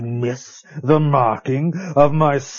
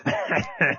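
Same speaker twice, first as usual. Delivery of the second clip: villain laugh; 6 dB quieter than usual. Acted. Villain gloats over little Billy.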